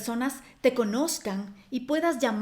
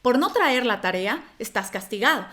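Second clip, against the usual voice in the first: firm, louder reprimand with a falling finish; direct teacher's scolding; 4 dB louder than usual. Acted. Teacher punishing a pupil for missing homework, firm direct scolding. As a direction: hand down the punishment straight at the pupil. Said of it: "siempre te miraba a los ojos".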